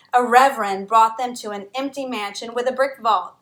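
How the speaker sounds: plain reading voice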